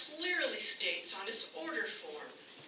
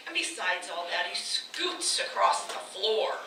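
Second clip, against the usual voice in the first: ordinary talk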